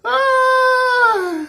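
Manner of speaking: very high, drawn-out cry of laughter; high helpless "ah"; long laughing wail. Real, not acted. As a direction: wail out at the tail of a laughing fit. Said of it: "when I laugh hard like this".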